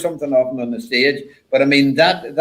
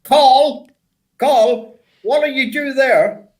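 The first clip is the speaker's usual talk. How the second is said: raised, high-pitched Yorkshire impression; Yorkshire accent; 13 semitones higher, slower than usual. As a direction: put on the co-driver's broad Yorkshire. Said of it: "in his clearest Yorkshire accent".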